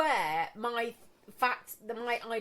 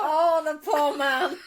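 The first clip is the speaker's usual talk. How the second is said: laughing; 4 semitones higher, 7 dB louder, slower than usual. Real, not acted. Laughing at her customer story.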